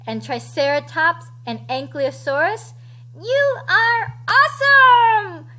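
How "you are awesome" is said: loud, high, drawn-out praise; admiring cheer. A narrator's reading. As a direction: shout it slowly, as a grateful crowd. Dinosaurs thank the chicken.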